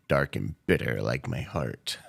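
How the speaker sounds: low sinister villain read; menacing low villain voice; evil villain voice. Real, not acted. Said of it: "Why do you sound like an evil villain".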